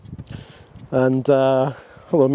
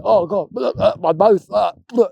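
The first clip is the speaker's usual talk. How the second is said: voicing the dog; 6 semitones higher than usual. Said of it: "He was like".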